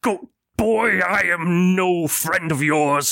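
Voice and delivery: put-on old man rejecting a former friend; cold old man. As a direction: turn suddenly cold and distant. Possessed old man turns cold.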